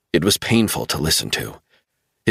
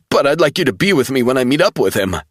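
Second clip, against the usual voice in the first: much higher, urgent pleading; desperate insistence; 7 semitones higher, 3 dB louder, faster than usual. A narrator's reading. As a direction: make him believe you. Travell pleading.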